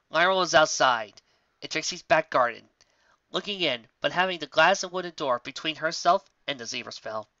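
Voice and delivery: ordinary delivery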